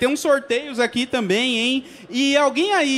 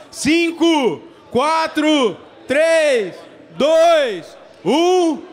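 building hype countdown, shouted; rising crowd countdown to a shout; counting down to go; 4 semitones higher, 3 dB louder, much slower than usual. Real, not acted. Host leads crowd countdown.

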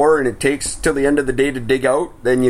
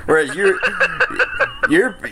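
ordinary talk

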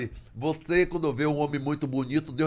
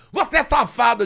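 accusing shout; 9 semitones higher, 8 dB louder than usual. Retold or imitated. Acusação alta.

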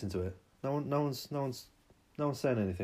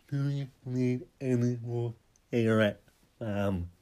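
the same question again, pushed louder; louder repeat; 4 dB louder than usual. Shown as a demonstration. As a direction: lean on it, louder and insistent. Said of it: "repeats the line louder".

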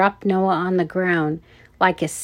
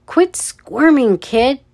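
loud, higher, drawn-out command; barked order; 6 semitones higher, 6 dB louder, slower than usual. A narrator's reading. Red-headed guard holds Noah, barked order. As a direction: bark it at a wriggling child. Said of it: "the red-headed guard barked".

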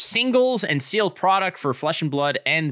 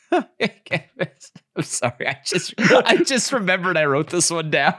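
higher voice breaking into giggles; laughing apology; 6 semitones higher, 3 dB louder, slower than usual. Real, not acted. Player cracks up at own next objection, laughing. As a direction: explain through real laughter.

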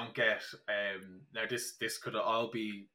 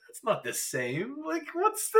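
puzzled surprise; 4 dB louder than usual. Retold or imitated. Retold tasting disappointment.

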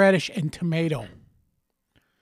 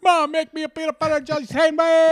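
put-on much higher, loud bratty kid; whiny kid demand; 11 semitones higher, 6 dB louder than usual. Kid demanding mom make a sandwich, bratty. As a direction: demand it like a spoiled child.

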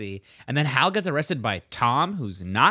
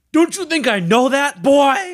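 much higher, louder mock bellow; imitating F P; 9 dB louder than usual. F P snapping at his son Jughead, high and loud. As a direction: throw it at his son like a drunk dad. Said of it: "F P's like".

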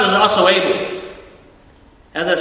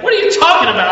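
higher, mock-incredulous Scottish mover; bad Scottish accent; 9 semitones higher, 4 dB louder, much faster than usual. Old Scottish mover dismissing the worry, imitated Scottish accent. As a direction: scoff in a rough imitation of Scottish. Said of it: "not doing a good Scottish accent".

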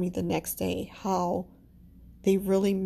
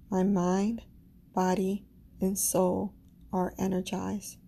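slow, calm affirmations with pauses; affirmation reading. Acted. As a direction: speak each affirmation slowly, meaning it. Leads listeners through affirmations.

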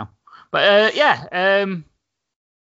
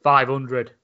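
deadpan low drop; 3 semitones lower than usual. Retold or imitated. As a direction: voice drops, flat and low. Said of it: "his voice sort of just drops".

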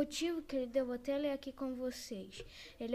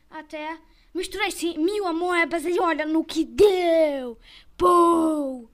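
much louder excited exclamation; shouting; 2 semitones higher, 14 dB louder, slower than usual. Acted. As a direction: blurt it out loud. Exclaiming at weird video.

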